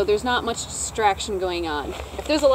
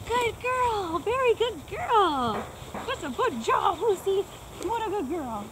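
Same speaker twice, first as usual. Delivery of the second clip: dog praise voice; 10 semitones higher, slower than usual. Real, not acted. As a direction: coo praise up high to a shy dog. Trainer praising shy dog Lucy.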